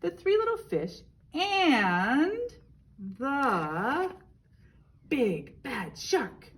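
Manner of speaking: high, loud, slow storybook title; drawn-out showman's title read; dramatic title reading. Shown as a demonstration. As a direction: announce the title grandly and slowly for the kids. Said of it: "Miss Wilson has a tongue twister".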